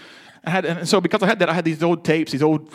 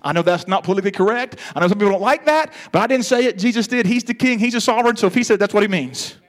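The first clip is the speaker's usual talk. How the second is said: ordinary talk, nothing marked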